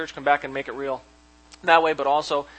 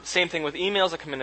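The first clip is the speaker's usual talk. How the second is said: usual speaking voice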